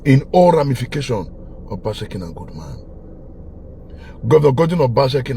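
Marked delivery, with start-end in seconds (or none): 1.7-2.8 hushed praise. Real, not acted.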